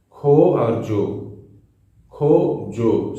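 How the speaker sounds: खो and जो stretched very slowly, each sound held; drawn-out rhyme words. Shown as a demonstration. Teacher showing students the kafiya rhyme, slow stretched words. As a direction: linger on खो and जो so the rhyme rings. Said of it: "same pronunciation".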